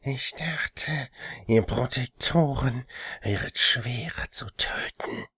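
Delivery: slow, menacing whisper; demon whisper. Acted. Demon taunts pinned victim.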